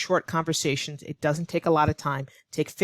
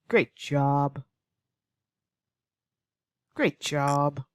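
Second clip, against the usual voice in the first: dry, lower sarcastic praise; 2 semitones lower, much slower than usual. Shown as a demonstration. Teacher showing sarcasm to a learner, dry mock praise. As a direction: say it like you don't mean it. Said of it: "add a sarcastic tone".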